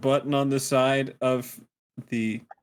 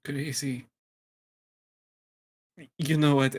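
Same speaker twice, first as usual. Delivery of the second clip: normal talking voice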